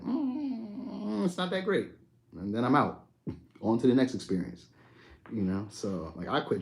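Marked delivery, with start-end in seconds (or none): 0.0-1.9 higher, softer mock letdown; a lightly voiced shrug that it's not that great; retelling his own letdown over something that looked good, unimpressed. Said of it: "I'm like".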